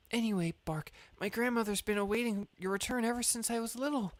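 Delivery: put-on high nervous stammering girl; shy girl voice. Voicing shy girl Paya.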